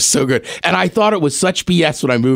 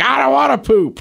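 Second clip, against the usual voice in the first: put-on higher, whiny little-boy voice; little boy whining to his dad in a fair toilet stall; 8 semitones higher than usual. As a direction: play the kid refusing the toilet. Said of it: "three and four-year-old boys just being like".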